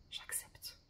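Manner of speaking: very soft, whispered affirmation; hushed acceptance. Acted. Guide models accepting one's emotions, hushed and whispered. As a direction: let it out under the breath. Voices acceptance, before the firmer turn.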